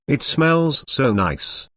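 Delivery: ordinary talk, nothing marked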